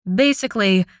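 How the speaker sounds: usual speaking voice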